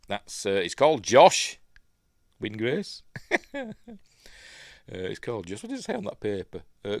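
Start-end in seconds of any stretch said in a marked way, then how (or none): 3.1-4.0 high little chuckle; short real laugh; amused laugh. Real, not acted. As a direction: giggle at your own remark. Amused at the name.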